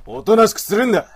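angry command. Acted. Wolf man orders struggling woman, loud angry bark. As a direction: snap at her to hold still.

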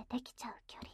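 soft intimate whisper. Acted. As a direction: breathe it close and quiet. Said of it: "ささやいてあげる".

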